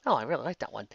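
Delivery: ordinary delivery, nothing marked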